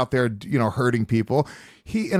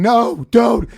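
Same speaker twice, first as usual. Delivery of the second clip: high, louder mock plea of a crying woman; imitating crying woman; 8 semitones higher, 6 dB louder than usual. Retelling a crying woman on video, mock pleading. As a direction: play the upset woman pleading, raised and loud. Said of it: "and she's crying".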